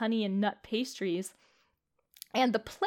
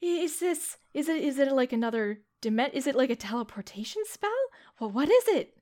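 startled wonder, voice up high; amazed; 5 semitones higher, 5 dB louder than usual. Acted. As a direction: gasp at the strange hallway. NPC amazed at portal.

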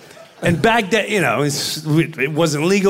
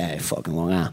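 much lower, softer mobster grumble; Joe Pesci impression; 14 semitones lower, 7 dB quieter, faster than usual. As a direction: scoff at Long Island low and quiet like Pesci. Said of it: "That's an incredible impression".

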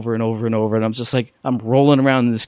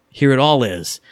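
ordinary talk, nothing marked

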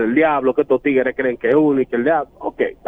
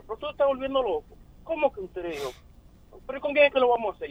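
high, soft, incredulous retold protest; raised-pitch quote of himself, half-hammed; incredulous mock high voice; 11 semitones higher, 8 dB quieter, faster than usual. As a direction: play it up as scandalized disbelief. Retelling his shocked reply to ex.

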